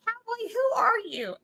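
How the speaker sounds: high teasing host question; playful question. Retold or imitated. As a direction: tease him on camera. Teasing cowboy on camera.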